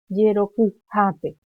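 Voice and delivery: yerokujape slowly, with "ku" and "ja" pushed loud; slow, emphatic model of yerokujape; loaded stress. Shown as a demonstration. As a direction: lean loudly on the accented syllables of yerokujape. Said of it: "se carga la voz".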